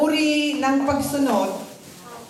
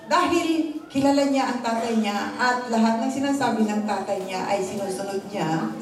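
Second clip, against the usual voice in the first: usual speaking voice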